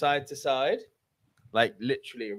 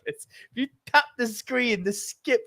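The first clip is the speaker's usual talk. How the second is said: high-pitched, exasperated ranting; exasperated outburst; 10 semitones higher than usual. Real, not acted. Friend berating co-host over skippable ads, high exasperated outburst. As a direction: blow up at your mate's stupidity.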